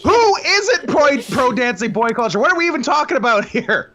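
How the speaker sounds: high-pitched incredulous mock protest; playfully outraged, voice jumping up; mock indignation. Co-host defending an absurd position, in mock outrage.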